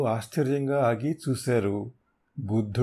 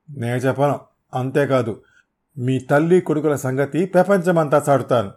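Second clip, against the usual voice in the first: louder stubborn refusal; defiant threat; 3 semitones higher, 6 dB louder than usual. Acted. Thug threatening to expose the hero, loud defiance. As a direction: spit back a threat.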